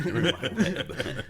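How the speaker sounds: high, slow cartoon-villain taunt with a laugh in it; mock menace, dragged out and high; gloating villain. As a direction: purr it like a spider that's caught its prey.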